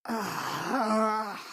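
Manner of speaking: long, frustrated groan; drawn-out exasperated "ugh". Acted. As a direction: groan in weary annoyance. Exasperated groan at being refused.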